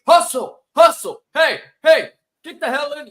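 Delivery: high, loud sideline coach bark; yelling coach. Retold or imitated. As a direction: scream it like an old-school coach. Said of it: "You need that Delco coach".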